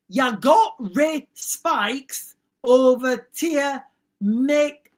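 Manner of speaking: slow, word-by-word reading; puzzled sounding-out. Real, not acted. Host retrying a comment he can't understand, slow and deliberate. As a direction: read it out slowly, piece by piece. Second try at baffling viewer comment.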